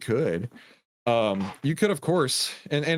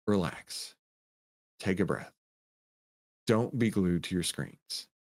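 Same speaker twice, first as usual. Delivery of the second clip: calm reassurance; 4 semitones lower, 5 dB quieter, slower than usual. Real, not acted. Calming anxious viewers.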